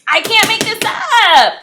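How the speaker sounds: annoyed outburst. Real, not acted. Reader venting to viewers, exasperated loud outburst. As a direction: shout it, fed up with the cards. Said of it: "I'm very annoyed".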